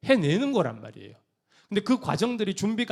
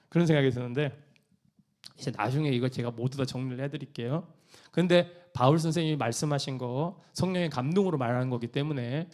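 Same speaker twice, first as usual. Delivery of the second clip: ordinary delivery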